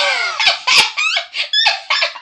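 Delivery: high mocking cackle; a high, far-off gleeful laugh; mocking laugh from Chloe; Chloe's laugh. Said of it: "Chloe was just like".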